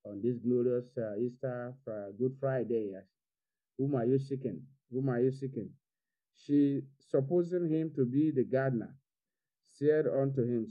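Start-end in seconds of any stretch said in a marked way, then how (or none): none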